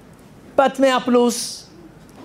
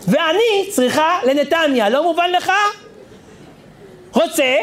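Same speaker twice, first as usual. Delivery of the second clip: put-on high, slow, indignant old lady; old woman arguing; 5 semitones higher, slower than usual. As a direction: drag it out like a stubborn grandma who won't budge. Old woman argues with the inspector. (זקנה מתווכחת בקול גבוה ואיטי)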